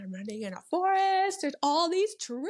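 high, loud and thrilled, wonder at the trees; awed excitement. Retold or imitated. Runner recalling her first forest ultra, excited awe. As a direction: gush like a city kid seeing a forest. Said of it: "I'm like".

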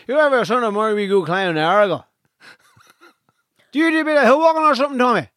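broad Donegal lads' voice, fast; quick, excited local Donegal lilt; Donegal accent. Retold or imitated. Lads inviting him, voiced quote.

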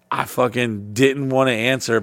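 normal talking voice

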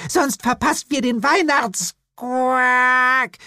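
put-on high girl's voice breaking into a croak; the word turns into a toad's quack; high child voice ending in a croak. A narrator's reading. Girl turns into toad mid-word.

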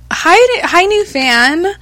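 cutesy greeting. Acted. Greeting a new fan.

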